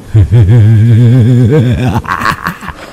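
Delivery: drawn-out ghostly laugh; eerie cackle. Acted. Possessed Ninh Thọt laughs at the gate, eerie cackle. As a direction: laugh like something not human.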